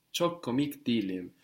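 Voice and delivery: ordinary talk, nothing marked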